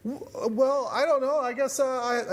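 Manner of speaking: high-pitched, hesitant imitation of being caught off guard; retold flustered stammer. Retelling her first answer to the talking AI, flustered.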